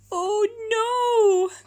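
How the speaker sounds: high tearful cry; a sudden wail of dismay. Acted. Giant finds the shop closed, crying out. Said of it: "cried George".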